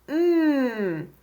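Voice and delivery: sustained humming mmm; letter sound M. Shown as a demonstration. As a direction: hold "mm" as one long lip-closed hum. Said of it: "M says".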